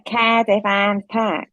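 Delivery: slow "Kairdiff" for Cardiff; slow Cardiff accent on "Cardiff Arms Park"; local Cardiff pronunciation. Shown as a demonstration. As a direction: stretch Cardiff with the local Welsh vowel. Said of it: "If you were from Cardiff".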